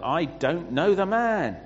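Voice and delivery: loud, high, drawn-out protest; vehement sworn denial. Acted. Peter swearing to bystanders he doesn't know Jesus, vehement denial. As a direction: swear it emphatically, slowing down and pushing the pitch up. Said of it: "he swore to them".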